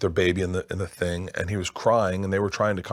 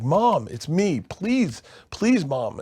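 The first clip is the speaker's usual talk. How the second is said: high-pitched imitated begging; tearful pleading; 8 semitones higher, much slower than usual. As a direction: plead like a crying child desperate to reach mom. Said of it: "And he's crying".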